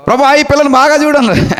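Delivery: high-pitched pleading prayer to the Lord; re-enacted prayer. Preacher re-enacting his prayer over a girl, high praying voice. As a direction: call out to God, high and earnest. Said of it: "నేను ప్రార్థన చేస్తూ".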